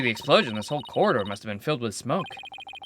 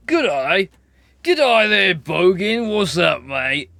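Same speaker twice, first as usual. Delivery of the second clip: Aussie g'day, louder, higher and broad; Australian accent; 4 semitones higher, 8 dB louder, much slower than usual. Shown as a demonstration. Teasing his partner with a loud Australian accent. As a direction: greet them like a true Australian bogan. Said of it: "good day in Australian".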